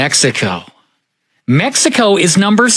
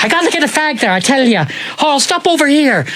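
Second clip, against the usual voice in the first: raised pitch, light Irish lilt; Irish cousin; 7 semitones higher than usual. Retold or imitated. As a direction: do the Irish cousin, chatty and higher. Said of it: "He's like".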